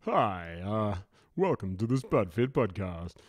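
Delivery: hammed-up nineties radio DJ; loud, cheesy commercial radio host. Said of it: "commercial radio stations of the nineties".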